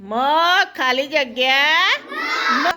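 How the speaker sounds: drawn-out, letter by letter with pauses; slow read-out. Shown as a demonstration. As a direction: read the gapped word slowly for a class. Teacher reads out gapped word.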